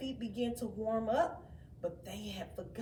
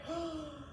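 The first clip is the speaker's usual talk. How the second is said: a small surprised intake of breath; soft gasp; 4 semitones higher, 7 dB quieter than usual. Acted. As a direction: gasp at the crowded pond. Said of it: "he said".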